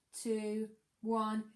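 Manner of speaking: ordinary delivery, nothing marked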